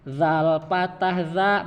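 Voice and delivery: slow, clear "Zal patah za"; teacher spelling out "Zal patah za"; slow spelling drill. Shown as a demonstration. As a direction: say "Zal patah za" slowly, letter by letter. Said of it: "Saya dulu, Pak, ya".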